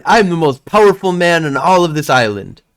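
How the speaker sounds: put-on cocky boy's big boast; boastful little boy. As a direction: puff yourself up like a proud child. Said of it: "he's like".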